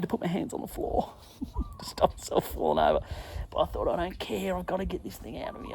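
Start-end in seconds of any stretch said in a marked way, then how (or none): 3.9-5.7 desperate inner voice. Retold or imitated. Retold desperate thought, muttered to himself.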